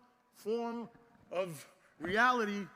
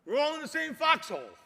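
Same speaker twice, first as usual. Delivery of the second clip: rallying call; 5 semitones higher, 9 dB louder than usual. Real, not acted. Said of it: "rallying repeat".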